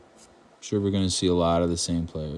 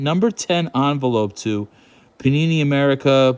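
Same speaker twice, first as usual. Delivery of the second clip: mock legal read; 4 semitones higher, 3 dB louder than usual. Reading the legal fine print aloud.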